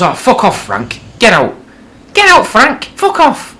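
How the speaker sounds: screaming wife. Acted. Wife to old husband in bedroom, high furious screech. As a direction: scream him out of the house.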